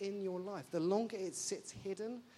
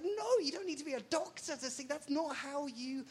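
exasperated; 7 semitones higher, 3 dB louder than usual. Retold or imitated. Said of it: "And you'd be like".

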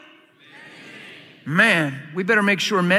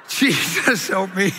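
laughing; 3 semitones higher, slower than usual. Real, not acted. Laughing at own joke.

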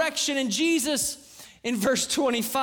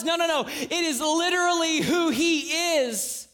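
ordinary talk, nothing marked

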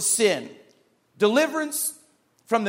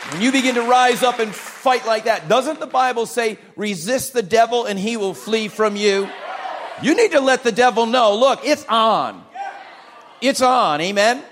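loud, higher fervent preaching; full-force preaching; 4 semitones higher, 4 dB louder than usual. Acted. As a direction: drive it home to the congregation.

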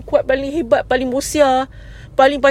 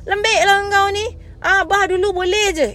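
slow, higher mock of a dismissive dad; scornful father; 5 semitones higher than usual. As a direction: brush off the crying kid like a tough father. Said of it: "tolak sikit, kau cakap".

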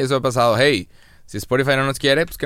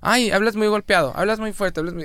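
high mocking fussy critic; high-pitched mimicry of a complainer; mock complaint; 9 semitones higher than usual. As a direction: parody their griping, pitched way up. Mimics people criticizing his accent.

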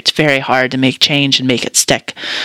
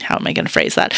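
muttered aside; 12 semitones lower, 6 dB quieter, faster than usual. Real, not acted.

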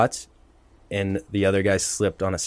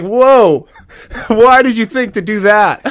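higher and louder, laughing through the words; laughing disbelief; 12 semitones higher, 10 dB louder than usual. Retold or imitated. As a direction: ask it while cracking up in disbelief. Retold disbelief, laughing while quoting.

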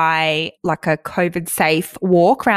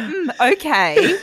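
higher, flat unimpressed "mm, okay"; unimpressed mimic; 7 semitones higher, slower than usual. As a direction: mimic a bored, unimpressed reply. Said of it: "You sound really unimpressed".

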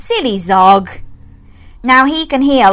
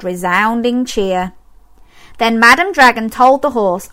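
usual speaking voice

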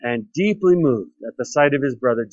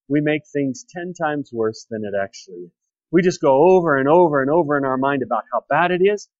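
normal talking voice

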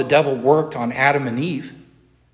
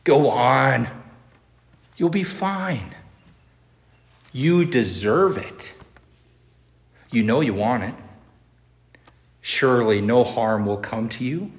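tempting devilish whisper; 4 semitones lower, 3 dB quieter than usual. Retold or imitated. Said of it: "Does the devil whisper in your ear like this?".

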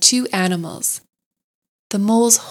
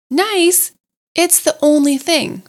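incredulous; 8 semitones higher, 3 dB louder than usual. A narrator's reading. Rat amazed at question.

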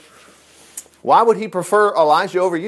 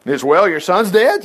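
slow, laughing mock of her words; laughing parody. Preacher mocks the woman's calm claim, laughing. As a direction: chuckle through the irony.

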